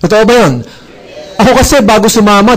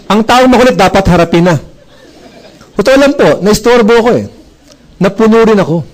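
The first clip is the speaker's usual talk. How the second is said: ordinary talk, nothing marked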